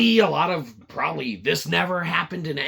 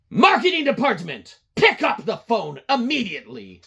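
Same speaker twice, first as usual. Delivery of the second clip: imperious boss; 7 semitones higher, 6 dB louder than usual. Retold or imitated. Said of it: "I will call my marketing".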